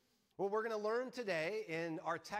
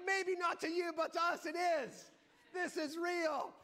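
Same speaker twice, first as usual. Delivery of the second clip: mean every word, higher; fervent insistence; 9 semitones higher than usual. Retold or imitated. Husband insisting to his wife the ride is real.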